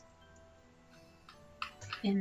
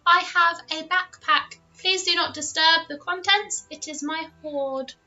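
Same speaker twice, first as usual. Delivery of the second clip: higher, prim little dragon voice; 7 semitones higher than usual. Acted. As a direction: speak like a fussy tiny dragon. GM voicing the dragon.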